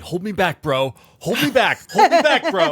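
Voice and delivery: hammed-up hothead pretending to want a fight; bar fight tough guy. Cohost playing the barroom tough guy, hammed-up and loud. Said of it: "is this like the bar fight".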